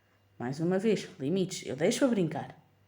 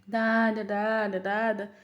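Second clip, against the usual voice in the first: higher, louder whimpered Dada; crying toddler; 3 semitones higher, 3 dB louder, much slower than usual. Retold or imitated. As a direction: mimic a toddler crying for daddy. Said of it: "Começou a chorar pelo pai".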